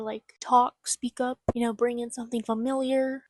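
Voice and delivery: normal talking voice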